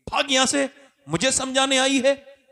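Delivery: angry dismissal. Acted. Chaudhry kicks her out.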